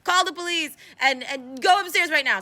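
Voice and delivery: high, loud urgent report; acted alarm. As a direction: raise a put-on alarm to the concierge. Voices Van alarming the concierge.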